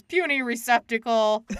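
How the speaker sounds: troll voice. Retold or imitated. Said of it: "when the troll says".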